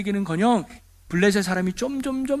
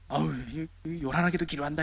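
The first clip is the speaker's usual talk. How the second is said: low, softer grumble under the breath; grumbling bystander; 3 semitones lower, 8 dB quieter than usual. Acted. Bystander complaining about a noisy pray-er, muttered grumble.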